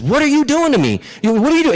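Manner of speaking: high, loud, outraged questioning; Briar Rabbit, madder. Acted. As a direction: demand it in cartoonish fury. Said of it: "he's getting more and more mad".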